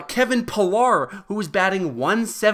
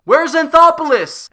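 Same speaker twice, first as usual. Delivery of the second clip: sudden high, loud call out; mock yell; 11 semitones higher, 9 dB louder than usual. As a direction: bellow the question like searching a crowd. Running gag, yelling for missing player.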